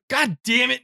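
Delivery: imitating a fed-up hero's curse; mock exasperated outburst. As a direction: throw it up in mock despair. Retelling Mandalorian's frustrated outburst.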